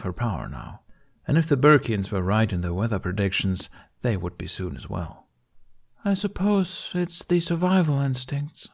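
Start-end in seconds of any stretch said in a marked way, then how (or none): 6.0-8.7 slow, higher airy queen voice. A narrator's reading. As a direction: float it, light and slow. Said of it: "in that airy voice of hers".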